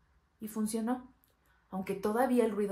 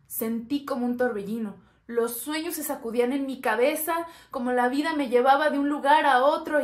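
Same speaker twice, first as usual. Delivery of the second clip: building louder and higher; overwhelmed outburst; 4 semitones higher, 8 dB louder than usual. Acted. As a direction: let the turmoil swell to a loud peak. Acted whirlwind of feelings.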